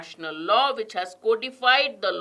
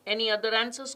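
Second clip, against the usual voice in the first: usual speaking voice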